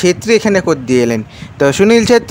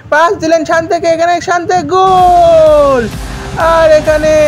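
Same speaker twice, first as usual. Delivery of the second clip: frantic football commentary; 5 semitones higher, slower than usual. Real, not acted. Goal scored in live commentary.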